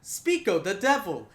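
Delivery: Scottish grandmother; thick Scottish accent. Acted. Bartender's grandmother confronting the barbarian, thick Scottish accent. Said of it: "says in a very thick Scottish accent".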